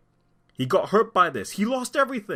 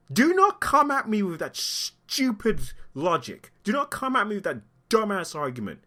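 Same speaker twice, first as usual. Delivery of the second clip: high, emphatic indignation; real anger; 7 semitones higher than usual. Real, not acted. Commentator rejecting the insurance excuse, angry and high. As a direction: warn them off, outraged and raised in pitch.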